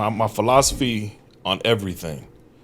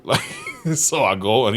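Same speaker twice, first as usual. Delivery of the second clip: usual speaking voice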